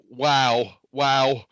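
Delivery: normal talking voice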